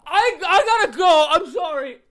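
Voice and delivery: high, loud, overwhelmed put-on wail; mock distressed. Sickened moviegoer excusing themself from the theater, hammed-up distress. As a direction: blurt it out as if desperate to escape.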